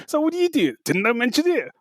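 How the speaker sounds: high, hammed-up question and reveal; mock movie-hero exchange; acted film dialogue. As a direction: replay the cheesy line with relish. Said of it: "Someone said".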